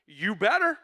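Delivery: high, loud, scolding order; stern demand. Pretend. Preacher mocking a pushy command, high and loud. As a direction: scold the congregation into thanking God. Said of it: "The psalm does not say".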